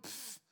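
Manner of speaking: soft scoffing puff of air; waving away the snake's offer with a soft scoff; dismissive pfft. Pretend. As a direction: brush the offer off with a quiet pfft. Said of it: "if they would have went".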